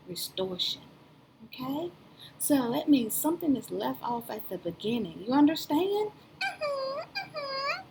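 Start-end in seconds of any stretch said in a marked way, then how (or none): 6.4-7.8 high, slow, squeaky puppet greeting; a cheerful little puppet says hello; high cartoon puppet voice calling out; puppet Leo voice.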